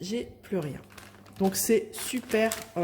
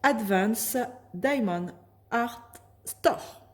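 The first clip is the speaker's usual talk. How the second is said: shop name said slowly in an English accent; apologising for her English accent on the shop name; 3 semitones higher, slower than usual. Real, not acted. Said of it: "excusez-moi pour mon accent anglais".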